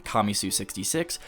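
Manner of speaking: ordinary talk, nothing marked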